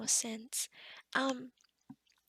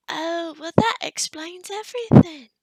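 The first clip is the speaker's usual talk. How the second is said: loud burst of understanding; loud, emphatic "aha" delivery; sudden realisation; 2 semitones higher, 13 dB louder than usual. Acted. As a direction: exclaim as it all falls into place. Realises the cause of the glow.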